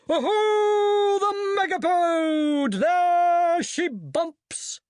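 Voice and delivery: very high, slow, stretched hunting call; hunting halloo. Acted. Wizards galloping after the beast, very high stretched halloo. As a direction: halloo like a huntsman sighting the quarry.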